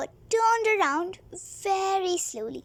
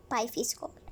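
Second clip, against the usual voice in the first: ordinary delivery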